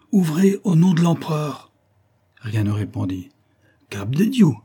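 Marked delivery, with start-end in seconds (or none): 0.1-1.7 loud, higher commanding cry; rough shout. A narrator's reading. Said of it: "cria une voix rude". 3.9-4.5 loud, higher-pitched curse; rough oath. A narrator's reading. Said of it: "dit la même voix".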